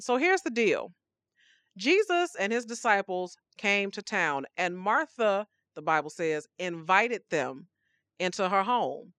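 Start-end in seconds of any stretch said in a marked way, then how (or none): none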